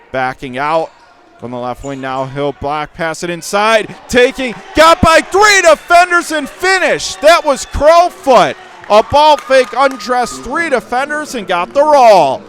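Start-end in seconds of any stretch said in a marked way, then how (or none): 4.1-12.4 excited call. Real, not acted. Big drive past three defenders.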